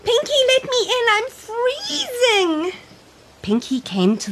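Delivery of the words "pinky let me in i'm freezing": put-on high, pleading child voice, slowed; cold little girl. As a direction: beg to be let in from the cold.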